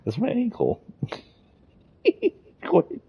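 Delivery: high, laughing protest; laughing in pain. Real, not acted. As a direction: laugh through the bite. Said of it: "Quit biting my foot".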